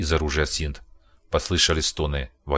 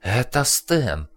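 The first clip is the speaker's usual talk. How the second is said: high, slow, stunned voice; terrified mutter; 7 semitones higher, much slower than usual. Acted. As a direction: blurt it out in horror. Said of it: "в ужасе пробормотал Дженнингс".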